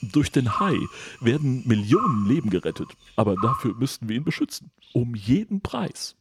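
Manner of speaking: leicht verstellte Stimme, am Ende langsam und leiser; entschlossener Bösewicht. Acted. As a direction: ein Hauch von fanatischem Chef. Mister Quan bedrohlich. (leicht verstellte Stimme, bedrohlich)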